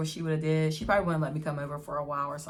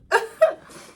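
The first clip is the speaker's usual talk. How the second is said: loud squeal of a laugh; real laughter; high laugh; 12 dB louder than usual. Real, not acted.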